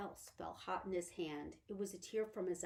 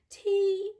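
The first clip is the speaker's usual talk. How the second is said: ordinary delivery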